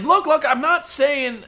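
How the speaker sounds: high, slow flustered backtracking; nervous retreat from the accusation; backpedaling. Retold or imitated. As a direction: scramble to take it back. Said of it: "Taz is backpedaling".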